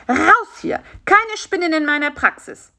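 high, sharp dismissal; indignant order out. A narrator's reading. Beetle doctor throws the spider out, indignant high order.

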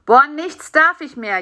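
mildly self-pitying complaint; whining. A narrator's reading. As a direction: whine like a sulky child. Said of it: "jammerte er".

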